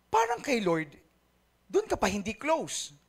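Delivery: ordinary talk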